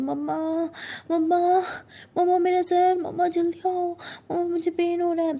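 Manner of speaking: high, loud pleading wail; crying out in pain. Acted. Siya calls her mother with splitting head pain, crying out. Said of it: "ज़ोर से चिल्लाने लगती है".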